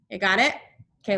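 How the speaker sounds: ordinary talk, nothing marked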